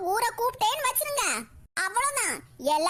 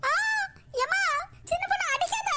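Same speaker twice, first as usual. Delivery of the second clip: pained plea; 7 semitones higher than usual. Acted. Woman being hit cries.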